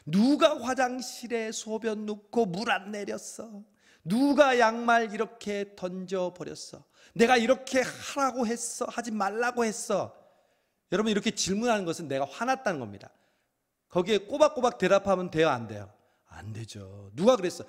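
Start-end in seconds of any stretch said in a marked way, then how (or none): 0.1-10.2 high, loud scolding questions, slowed down; scolding parent. Retold or imitated. Parent scolding the kids, angry barrage of questions. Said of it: "질문을 퍼붓습니다". 17.1-17.6 angry parent. Retold or imitated. Acted parent-child quarrel.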